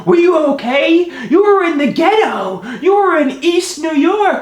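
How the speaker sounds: high-pitched frantic mother; concerned. Retold or imitated. Worried mother greeting her son at home, high frantic concern. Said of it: "my mother is all concerned".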